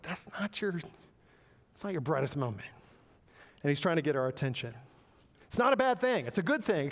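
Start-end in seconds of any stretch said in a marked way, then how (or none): 0.0-2.7 God's gentle correction. Acted. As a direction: gently let them down, taking your time. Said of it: "God's like".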